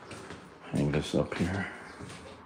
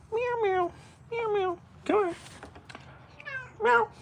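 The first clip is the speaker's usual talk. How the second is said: squeaky high meowing; high cat meow; 3 dB quieter than usual. Pretend.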